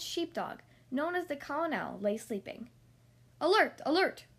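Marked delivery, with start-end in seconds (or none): 3.4-4.3 loud, high urgent cry of alarm; cat sentry shouting the alarm at night. A narrator's reading. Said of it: "shouted Sergeant Tibbs".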